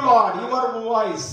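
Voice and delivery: ordinary delivery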